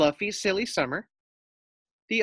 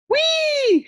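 very high, loud squeal; gleeful whee; 8 dB louder than usual. A narrator's reading. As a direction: shriek with delight. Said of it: "she cried".

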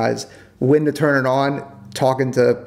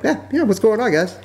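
casual friendly switch; 9 semitones higher than usual. Retold or imitated.

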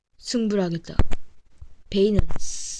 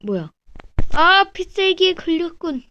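anime character voice; 6 semitones higher than usual. Acted. Voicing character mid-battle.

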